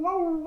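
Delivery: high, loud yelp; animal yelp. Shown as a demonstration. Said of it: "the crocodile and the ow".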